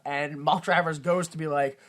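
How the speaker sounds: ordinary talk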